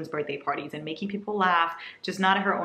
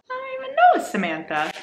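mock squeak; 3 dB louder than usual. Joking about a stranger's name.

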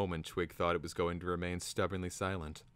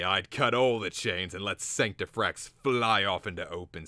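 loud, punchy grumbling trader; grumbling shopkeeper; 8 dB louder than usual. A narrator's reading. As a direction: rail loudly against the academics. Flab Sweat voiced in narration.

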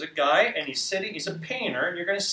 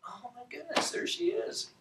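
high, soft little squeal of amazement; replayed awe, tiny and high; hushed amazement; 11 semitones higher, 7 dB quieter than usual. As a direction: marvel quietly in a very high voice. Acts out spotting the woman.

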